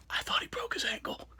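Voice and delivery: hushed, voiceless aside; whisper. Retold or imitated. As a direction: whisper it in dramatic concern. Worried aside about an injury.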